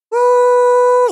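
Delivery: very high, loud woo; explosive hype whoop; loud whooping cheer. Real, not acted. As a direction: burst into a piercing whoop. Cheering the stream.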